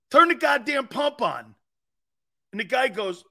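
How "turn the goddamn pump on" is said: higher, louder mock demand; retold annoyed order. Host retells ordering the gas clerk, higher mock-angry demand. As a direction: snap at the clerk to turn it on. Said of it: "I'm very aggravated".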